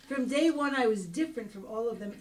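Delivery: ordinary talk, nothing marked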